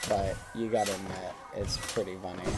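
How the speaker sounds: quietly trying not to laugh; stifled laugh. Real, not acted. Streamer apologizes to chat while suppressing giggles, softly.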